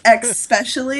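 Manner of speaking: "especially" said as "ex-specially". Shown as a demonstration. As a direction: put an X at the start of "especially". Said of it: "it's X approved".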